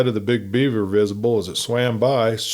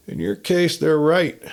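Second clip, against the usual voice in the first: sly; 6 semitones higher than usual. A narrator's reading. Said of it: "I said slyly".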